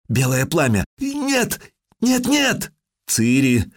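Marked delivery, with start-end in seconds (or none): 1.0-2.7 high frightened no; panicked cry. Acted. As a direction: cry out in terror. Кошмар Цири, крик ужаса. (испуганный крик)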